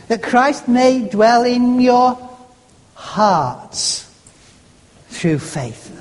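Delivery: slow, suspended build to "hearts", set alone between long pauses; stress on hearts. Shown as a demonstration. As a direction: hold back, then let "hearts" land by itself. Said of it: "emphasizing a different word each time".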